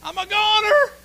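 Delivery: high, loud, drawn-out cries for help; desperate hollering. Acted. Climber dangling from a branch hollering up the cliff for help. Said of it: "he's hollering up the top".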